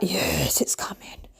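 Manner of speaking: soft hissed, whispery relish; re-enacted gleeful whisper; hushed anticipation. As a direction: whisper it with secret glee. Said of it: "And I'm like".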